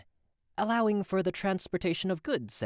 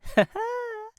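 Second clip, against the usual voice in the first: high laugh. Acted. Laughs off the cost question.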